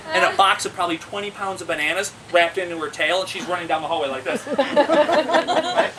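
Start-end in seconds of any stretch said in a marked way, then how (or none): 4.2-5.9 laughing. Real, not acted. Laughing, monkey running off.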